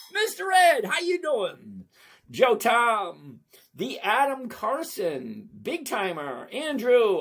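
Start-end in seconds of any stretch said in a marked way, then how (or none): 0.1-1.8 high, loud excited call of a name; excited shout. Real, not acted. Greeting the viewer again, loud excited call.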